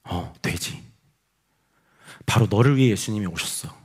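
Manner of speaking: soft, low, tender answer; gentle reassurance. Retold or imitated. Pastor telling a drunk man he may believe, gently. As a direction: reassure softly and sincerely.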